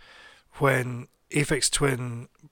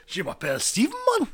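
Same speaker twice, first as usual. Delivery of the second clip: higher, hammed-up Geordie French with a rising lilt; Geordie accent. Shown as a demonstration. Introducing himself in French for a joke, Geordie accent. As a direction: put on a comic Geordie accent over the French. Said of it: "I'm gonna do Geordie".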